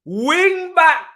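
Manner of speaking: high, loud incredulous yell; outraged shout. Real, not acted. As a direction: blast it in disbelief. Fan outraged by player position.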